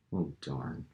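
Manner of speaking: soft, low playful sigh; mock disappointment. Anne teasing Charlie, softly mock disappointed. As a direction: pretend to be let down.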